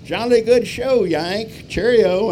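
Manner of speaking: put-on high, very loud plummy British accent; English gent cheerily driving off, British accent; hearty British farewell. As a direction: boom it out like a jolly English gentleman. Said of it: "an old duffer".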